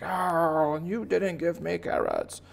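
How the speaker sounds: high, mock-outraged bunny voice; aggrieved baby bunny. Bunny blaming the city over carrots, high complaint. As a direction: wail a bunny's grievance up high.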